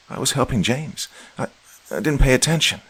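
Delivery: plain reading voice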